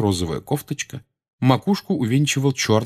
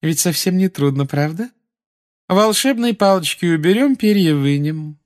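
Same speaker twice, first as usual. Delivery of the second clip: high, sugary schoolmistress; honeyed voice; 10 semitones higher, 4 dB louder than usual. A narrator's reading. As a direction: coax with sticky sweetness. Umbridge continues.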